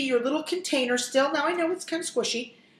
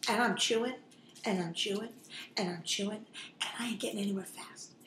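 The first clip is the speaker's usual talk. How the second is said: each repeat softer, ending in a whisper; fading chewing complaint; 3 semitones lower, 11 dB quieter than usual. Retold or imitated. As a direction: let it fade away into a whisper. Retelling chewing a tough salad.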